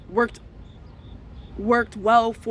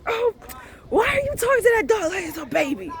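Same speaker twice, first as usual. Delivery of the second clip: high, fast mock-scolding voice; mocking imitation; 11 semitones higher, faster than usual. Making fun of people who baby-talk dogs, mocking. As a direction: mimic someone teasing, pitched way up. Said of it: "I always make fun of people".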